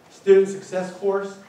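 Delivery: ordinary delivery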